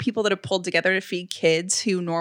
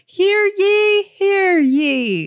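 town crier; 11 semitones higher, 5 dB louder, much slower than usual. Retold or imitated. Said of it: "And you're like".

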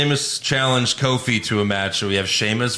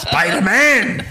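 slow, loud dramatic cry; hammed-up villain yell; overacted death scene; 8 semitones higher, 3 dB louder, slower than usual. Repeating the death-scene bit.

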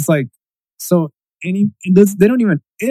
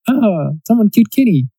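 retold mock reaction; 7 semitones higher than usual. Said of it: "It's always like".

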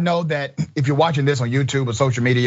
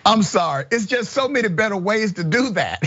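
normal talking voice

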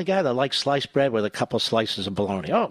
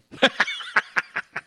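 1 breathy laugh; 9 semitones higher than usual. Real, not acted. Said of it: "host laughs at own joke".

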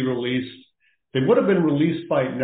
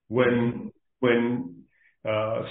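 ordinary talk

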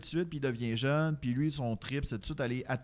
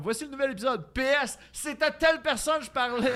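announcer voice; 10 semitones higher, 5 dB louder than usual. Pretend. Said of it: "je vais faire comme".

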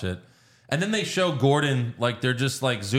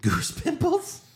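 high, slow, incredulous goose pimples?; mocking disbelief; slower than usual. Real, not acted. Friend mocks Josh's word choice, incredulous high rising question. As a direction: scoff at the words goose pimples?, pitch soaring on a rising question. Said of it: "Don't ruin it".

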